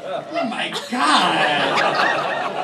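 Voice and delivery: retelling the group's horror while laughing; laughing through it. Real, not acted. The teller laughing hard at the group's shock.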